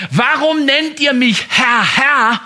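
langsam und hoch, Warum betont; fragender Jesus. Acted. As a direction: lass Jesus eindringlich fragen. Jesus zitiert, Predigt mit Nachdruck. (Jesus fragt eindringlich, langsam und hoch)

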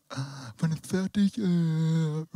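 slow, listless mock of a bored audience; mock bored listener. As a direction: drag it out like someone waiting for it to end. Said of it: "alle anderen gucken mich so".

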